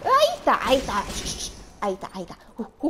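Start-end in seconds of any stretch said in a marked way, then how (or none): 0.0-1.0 high, loud excited call; high loud sudden callout; excited spot. Real, not acted. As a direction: call out the target. Spotting an enemy.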